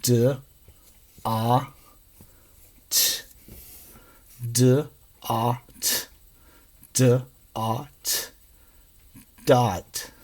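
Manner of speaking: soft, sound by sound, then join them; slow blending. Shown as a demonstration. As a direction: sound out "Duh, ah, t" separately, then blend into "Dot". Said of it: "We're gonna make words".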